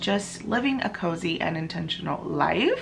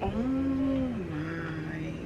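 awed hush; 10 dB quieter, slower than usual. Real, not acted. Quiet awe over finished book.

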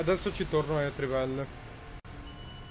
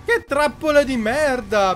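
louder, higher, slow disgusted curse; slow furious curse; 7 semitones higher, 8 dB louder than usual. Real, not acted. Cursing at the trap. (imprecazione lenta e furiosa)

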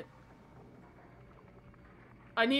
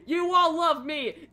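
louder, higher anime protagonist shout; loud, punchy cartoon hero; 3 semitones higher, 7 dB louder than usual. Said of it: "Not the anime voice".

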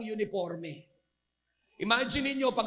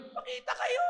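very high-pitched mock command; acted high shout from above; Lord. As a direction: call it out in a high put-on voice. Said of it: "sabi ni Lord".

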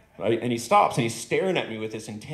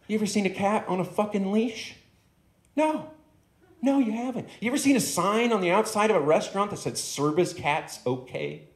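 higher, incredulous rhetorical questions; ranting dog; 8 semitones higher than usual. Acted. The dog quizzes the stoned man on cats, higher and incredulous. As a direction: hammer each question like an aggrieved debater. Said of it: "He says".

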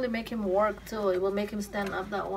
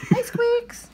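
very high, louder greeting to the pet; squeaky pet greeting; 7 dB louder than usual. Real, not acted. Owner greets the little pet in a very high, squeaky voice. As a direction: chirp hello way up high.

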